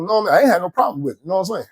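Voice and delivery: usual speaking voice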